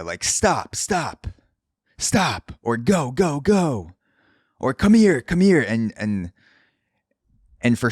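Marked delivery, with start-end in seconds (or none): 0.2-2.5 high mock shouts of stop, each one falling; mock yelling. Imitating a street guy yelling at the air. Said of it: "yelling into the air". 2.8-3.9 high mock shouting of go; mock yelling. Imitating a street guy yelling at the air. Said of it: "yelling into the air". 4.7-5.6 high, loud call of come here; yelling. Retold or imitated. Street guy yelling at the wind, calling out. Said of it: "yelling into the air".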